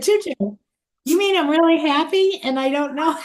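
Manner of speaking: put-on high child's voice asking in wonder; grandma voicing her grandson's amazed question, pitched high; imitating a small child. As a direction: pitch way up to play the little grandson.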